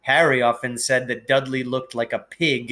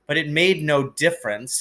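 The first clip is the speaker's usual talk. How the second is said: plain reading voice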